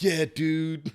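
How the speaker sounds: hammed-up American accent. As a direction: imitate a footballer putting on an American accent. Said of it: "in the interview with American accent".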